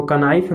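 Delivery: कनाइफ with k and a short vowel before n; wrong sounded K. Shown as a demonstration. Teacher warns against this reading of knife, k sounded. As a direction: add a k syllable to open कनाइफ. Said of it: "नहीं पढ़ेंगे".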